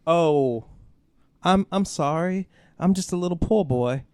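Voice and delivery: simper it like a Southern belle begging; mock Southern belle. Mocking writers who claim AI helps the poor, hammed-up Southern belle. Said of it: "Why is that poor boy a Southern belle?".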